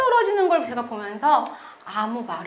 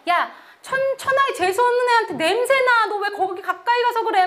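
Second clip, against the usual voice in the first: much higher, louder sneering schoolgirl voice; mocking bully; 9 semitones higher, 4 dB louder than usual. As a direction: jeer at the others to stay away. Bully mocks her to others. (높고 비아냥거리는 여학생 목소리)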